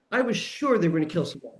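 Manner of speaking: normal talking voice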